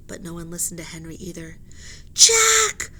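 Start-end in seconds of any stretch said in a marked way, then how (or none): 2.1-2.9 high, very loud call; frantic cry. Acted. Annie cries to her brother, high and very loud. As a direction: cry his name in panic. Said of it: "cried Annie".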